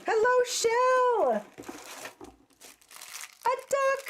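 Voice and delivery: high, slow cooing hello; cooing greeting. Real, not acted. Greeting a craft piece.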